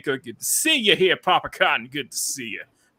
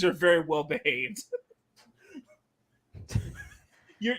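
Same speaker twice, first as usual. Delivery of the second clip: ordinary talk, nothing marked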